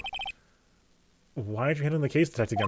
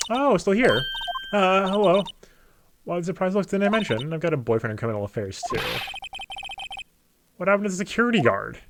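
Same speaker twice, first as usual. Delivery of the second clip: high flirty voice; 6 semitones higher, 3 dB louder than usual. Acted. Voicing a flirty woman.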